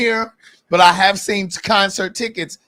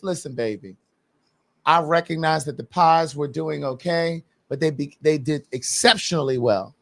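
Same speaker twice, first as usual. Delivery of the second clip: low, soft and slow, sweet-talking; soft coaxing voice; 6 semitones lower, 4 dB quieter than usual. Retold or imitated. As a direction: coax him gently, like a lover. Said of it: "what she should have said was".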